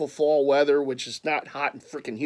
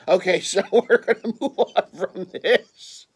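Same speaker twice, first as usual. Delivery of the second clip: laughing; 4 semitones higher, 4 dB louder than usual. Real, not acted. Laughing while moving on.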